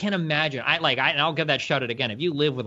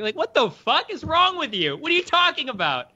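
exasperated disbelief; 13 semitones higher than usual. Retold or imitated. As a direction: go way up in pitch, stretch it out in disbelief. Exasperated at refund customer.

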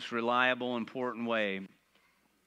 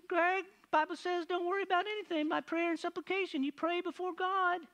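much higher, quick mock wife voice; imitating wife quoting scripture. Wife quoting scripture at panicking husband, high and quick mock. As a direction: rattle off the verse in a high put-on wife voice. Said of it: "she says".

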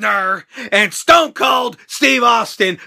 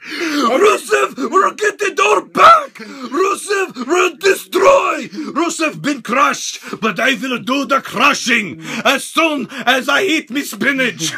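hammed-up Rusev boast; 4 semitones higher, 3 dB louder, slower than usual. Rusev voiced, Popeye-style boast.

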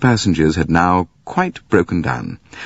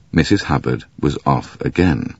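ordinary delivery, nothing marked

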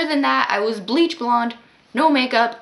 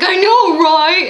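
put-on much higher and louder drunk voice; loud drunk agreeing; 8 semitones higher, 10 dB louder, slower than usual. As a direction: bellow it like a proud drunk. Drunk man to girls.

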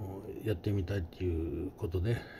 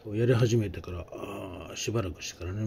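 ordinary delivery

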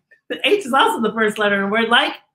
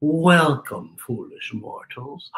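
much lower, soft, slow ghostly greeting; ghost host voice; 9 semitones lower, 3 dB quieter, slower than usual. Acted. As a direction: welcome them like a spooky host. Haunted Mansion ghost host.

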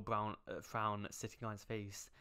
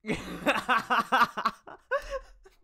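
loud, high burst of laughter; huge shrieking laugh; 16 dB louder than usual. Real, not acted. Streamer losing it at the slap scene, screaming laugh. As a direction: explode into helpless laughter.

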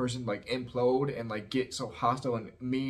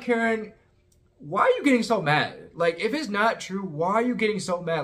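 much higher and louder, incredulous challenge; exasperated call-out; 8 semitones higher, 5 dB louder than usual. Retold or imitated. Host retelling calling out Karen at dinner, exasperated and loud. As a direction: shout it across the table in disbelief.

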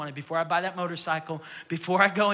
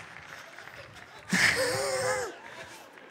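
high breathy laugh. Real, not acted. Laughs at volunteer.

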